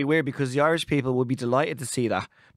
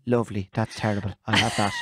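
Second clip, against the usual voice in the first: dad's gloomy relish; 8 semitones lower than usual. Retold or imitated. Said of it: "he goes".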